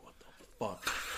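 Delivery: breathy spooked whisper, slow; startled whisper. Retold or imitated. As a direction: hiss it out like you just got scared in the dark. Spooked by rustling bushes.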